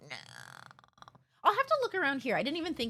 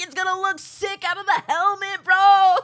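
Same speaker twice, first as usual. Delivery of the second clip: hyped bro; 7 semitones higher, 8 dB louder than usual. Retold or imitated. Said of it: "they were hyped about it".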